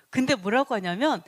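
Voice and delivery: normal talking voice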